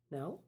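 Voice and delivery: "No" as a timid question, rising sharply; tentative "No?" swooping up at the end; question-mark "No". Acted. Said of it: "I say it with a question mark".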